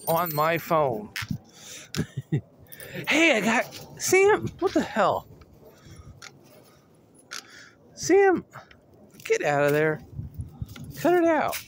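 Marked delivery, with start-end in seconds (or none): 3.1-11.6 high-pitched exasperated calls; squeaky telling-off; scolding the dog. Real, not acted. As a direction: call the dog off, pitch jumping up. Scolding Sam, who is running off.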